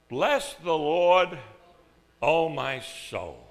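slow, loud, solemn recitation; drawn-out pulpit reading; scripture reading. Acted. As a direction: intone the verse like a proclamation. Pastor reads the psalm aloud.

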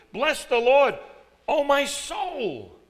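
preaching at full force; emphatic proclamation. Acted. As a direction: shout the verse with David's full heart. Preacher proclaims David's emphatic verse.

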